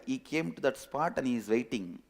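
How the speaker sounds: ordinary delivery, nothing marked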